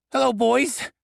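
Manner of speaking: a goblin badly aping his boss's cool line; failed debonair imitation. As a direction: goblin copying his boss's greeting, squeaky failed menace. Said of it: "trying and failing miserably to imitate my threateningly debonair tone".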